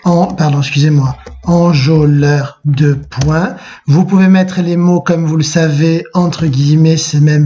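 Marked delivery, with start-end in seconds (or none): 1.4-3.6 slow, syllable-by-syllable dictation; measured note-taking pace. Shown as a demonstration. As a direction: dictate it slowly, word by word. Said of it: "On marque donc".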